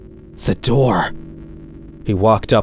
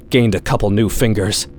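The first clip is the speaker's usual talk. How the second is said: ordinary delivery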